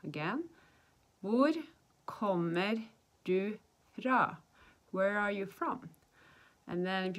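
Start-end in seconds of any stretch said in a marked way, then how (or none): 1.2-4.4 very slow, one word at a time; slow teaching model. Shown as a demonstration. Teacher showing how to ask where someone's from, slow demonstration. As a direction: separate each word for learners to copy. Teacher repeats the question slowly.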